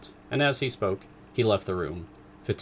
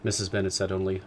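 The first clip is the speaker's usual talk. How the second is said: usual speaking voice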